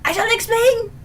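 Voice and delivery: high, loud playful protest; mock exasperated outburst. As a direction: blurt it out in mock frustration. Mock outburst answering viewer.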